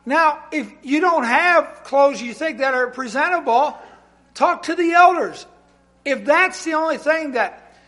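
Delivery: emphatic preaching. Acted. Preaching at full force.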